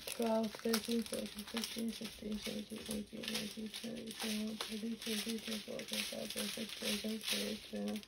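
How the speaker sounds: fading mutter while counting; counting under breath. Real, not acted. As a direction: count quietly to yourself, trailing off.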